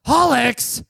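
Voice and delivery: high loud yell of Hallix; Italian grandma yelling. Retold or imitated. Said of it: "She's always yelling it".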